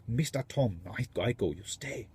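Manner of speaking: put-on soft, amateur Indian accent; rough mock Indian accent, insisting; imitated Indian accent. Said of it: "That's my version of his accent".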